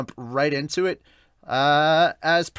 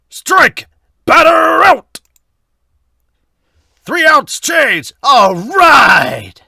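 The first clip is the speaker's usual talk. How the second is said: umpire shout; 13 semitones higher, 15 dB louder, much slower than usual. Acted. Voicing umpire and players in manga.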